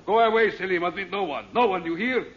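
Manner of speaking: loud, high commanding shout; barked orders. Acted. Oxo ordering his servant away, shouting. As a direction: send the servant off sharply.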